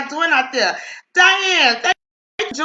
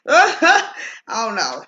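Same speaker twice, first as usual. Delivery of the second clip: laughing; 2 semitones lower, much slower than usual. Real, not acted. Laughs after retelling grandbaby's question.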